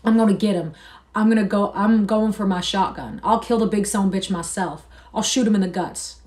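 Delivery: furious. A narrator's reading. Curly vowing to shoot Lenny, loud fury. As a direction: work yourself into a fury. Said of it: "He worked himself into a fury".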